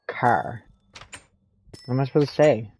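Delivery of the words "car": "car" with the R said like the letter's name; "car", ending just like the letter R. Shown as a demonstration. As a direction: demonstrate "car" with a full "ar" sound. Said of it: "like I'm pronouncing just a letter".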